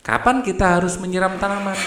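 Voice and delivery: high, fast question with Kapan leading; question intonation. Shown as a demonstration. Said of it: "intonasi dan nada bertanya dengan tepat".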